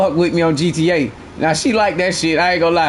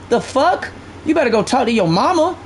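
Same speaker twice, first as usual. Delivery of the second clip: usual speaking voice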